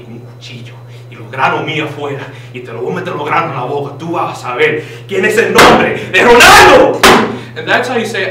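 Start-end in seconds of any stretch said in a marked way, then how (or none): 2.8-7.0 mock threat. Fake teacher shouting his name at the victim. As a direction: proclaim your name as a threat.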